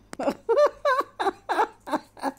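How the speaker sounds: high laughter running out of air; breathless high laugh. Real, not acted. Winded from laughing, breathless high laugh. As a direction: laugh on what breath is left. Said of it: "I'm out of breath".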